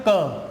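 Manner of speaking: "क" as a hard k sound; C का उच्चारण. Shown as a demonstration. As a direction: sound out "क" for the letter C. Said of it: "कैसे उच्चारण करते हैं". ("क" की कठोर ध्वनि)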